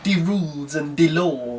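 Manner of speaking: lightly put-on accent on "De rules"; a hint of a caricature accent; mock African accent. Mocking the novel's accent writing, light mock accent. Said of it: "what Eric Onyema was given".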